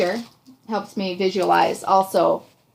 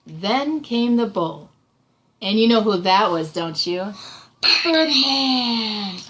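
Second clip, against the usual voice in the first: lively picture-book narration; dramatic storytelling; 3 semitones higher than usual. A narrator's reading. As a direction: build suspense for the child, savoring names. Reads story aloud to child.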